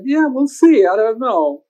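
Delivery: high noncommittal yeah, we'll see; mock dad brushing off. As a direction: play the dad shrugging it off. Father voiced, noncommittal.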